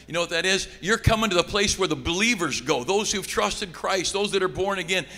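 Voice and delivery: high, loud, fervent preacher's cry; preaching at full force. Acted. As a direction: lift the room as you proclaim heaven.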